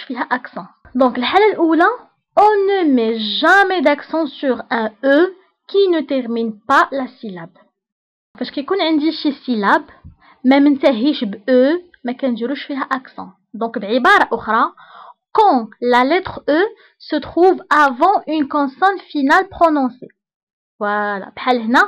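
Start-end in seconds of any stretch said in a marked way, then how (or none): 2.4-7.6 slow, deliberate dictation of a rule; dictating to a class. Shown as a demonstration. Teacher dictates French rule. 15.4-20.1 slow, deliberate dictation of a rule; dictating to a class. Shown as a demonstration. Teacher dictates French rule.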